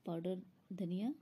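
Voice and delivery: ordinary talk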